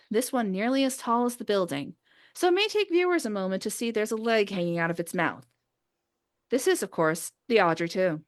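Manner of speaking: ordinary talk, nothing marked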